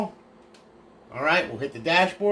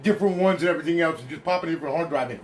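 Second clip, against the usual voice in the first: smartass; 3 semitones higher, 3 dB louder than usual. Retold or imitated. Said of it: "I had some smartass say".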